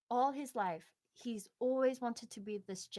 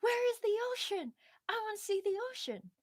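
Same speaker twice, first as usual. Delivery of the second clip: put-on eager young fish; 12 semitones higher, 4 dB louder, faster than usual. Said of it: "the young fish is like".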